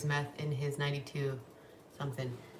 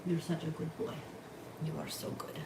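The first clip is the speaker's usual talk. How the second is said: soft dog praise; 4 dB quieter than usual. Real, not acted.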